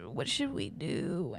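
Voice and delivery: slow, soft imitation of a weepy boyfriend; mock crying. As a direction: imitate him pleading softly and tearfully. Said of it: "come to me and cry".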